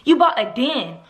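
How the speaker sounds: put-on indignant young mother firing practical questions; Rena. Rena confronting Darnell about the surprise house, exasperated. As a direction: challenge him, pitch jumping on the key complaints.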